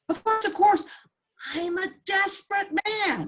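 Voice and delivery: high, pleading rush; a desperate farmer grabbing at help; desperate eagerness. Acted. Desperate man pleads with rabbi.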